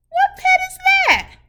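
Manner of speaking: high, fast, eager quiz question; excited question. Acted. Teacher quizzing children on the pet, high and eager.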